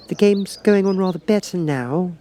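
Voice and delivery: high, polite small talk; polite Alice. A narrator's reading. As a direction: keep the chat going. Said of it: "by way of keeping up the conversation".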